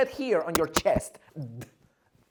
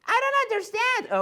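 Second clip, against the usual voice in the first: confused student; 5 dB louder than usual. Pretend. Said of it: "voicing a confused student".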